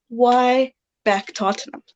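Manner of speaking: ordinary delivery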